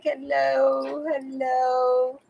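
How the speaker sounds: high, slow cat voice showing off; a preening cat saying hello to the world; voicing the cat. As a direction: owner speaking for her cat, high and slow. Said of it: "It's like".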